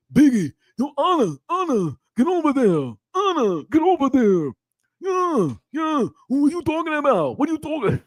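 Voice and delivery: high-pitched mock confrontation. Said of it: "This would have been [name]".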